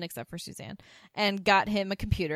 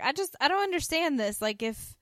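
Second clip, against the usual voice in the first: higher, a naive mock puzzle; imitating Charlene; 7 semitones higher, 4 dB louder than usual. Charlene asking about computer viruses, in a higher, wide-eyed voice. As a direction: wonder aloud, wide-eyed. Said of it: "Charlene's like".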